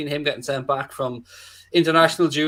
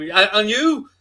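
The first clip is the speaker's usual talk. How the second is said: baffled official; 6 semitones higher, 3 dB louder, slower than usual. Retold or imitated. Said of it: "no clue about how to pronounce a name".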